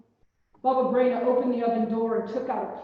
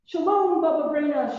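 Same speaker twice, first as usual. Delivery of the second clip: higher, louder group greeting through the door; shouted greeting; 5 semitones higher, 5 dB louder than usual. A narrator's reading. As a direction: shout a cheerful hello. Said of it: "shouted their friends and neighbors".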